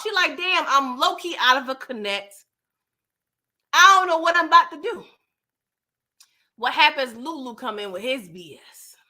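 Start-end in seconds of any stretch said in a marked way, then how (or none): 0.4-5.1 acting out frustrated anger, rising louder; retold pissed-off. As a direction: vent as someone left stranded. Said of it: "And she pissed off".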